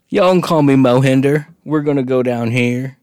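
a loud hammed-up southern American accent. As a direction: Indian tour guide with a southern American accent. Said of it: "southern Indians sounded like southern Americans".

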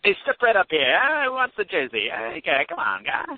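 high, hammy carnival barker call; old-timey carny barker. Pretend. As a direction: bark it like a fairground huckster. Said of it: "an old-timey accent".

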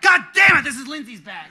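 very high, loud outburst; retold shouted curse; exasperated shout. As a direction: yell it in comic outrage. Retold outburst on spotting wrong bag.

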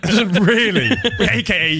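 high, giggling repeat; laughing. Real, not acted. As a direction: laugh through the words. Laughing echo of the phrase.

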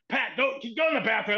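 retold impatient command; imitated bossy order. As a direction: order him off in feigned anger. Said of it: "laying into Pat".

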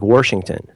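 WASHINGTON said "WORSH-ington"; added R. Shown as a demonstration. Midwesterner owns up to a habit, WASHINGTON as "Worshington". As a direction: slip an R into the first syllable of WASHINGTON. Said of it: "add a lot of unnecessary R's".

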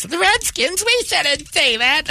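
high, slow mocking lady voice; mock the scolding do-gooder; mocking prissy voice.

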